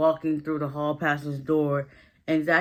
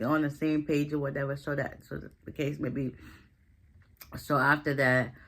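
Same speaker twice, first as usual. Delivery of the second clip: usual speaking voice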